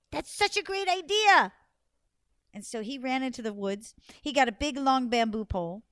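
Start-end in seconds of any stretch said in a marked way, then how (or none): 0.1-1.5 high, loud burst of delight; gasp, then exclaim in delight. Acted. Anansi delighted by wife's plan.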